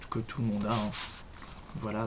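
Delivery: normal talking voice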